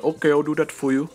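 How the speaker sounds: hammed-up shopkeeper voice; mock shop guy. Shop guy voiced in retold story.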